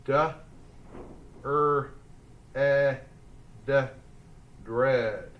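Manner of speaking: "dread" split into D, er, eh, d, then blended; sounding out. Shown as a demonstration. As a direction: phonics drill on "dread" with pauses. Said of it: "Roller coasters ready?".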